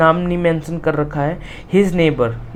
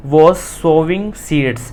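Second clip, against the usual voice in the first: slow reading; much slower than usual. Shown as a demonstration. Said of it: "teacher reads the sentence slowly".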